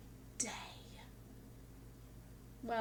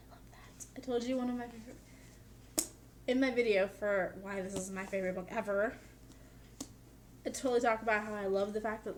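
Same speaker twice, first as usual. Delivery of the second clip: ordinary delivery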